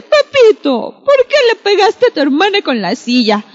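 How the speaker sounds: a mock-angry mom calling Pepito out; angry mother. Mother telling off Pepito for hitting his sister, mock angry and high. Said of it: "muy enojada le dice".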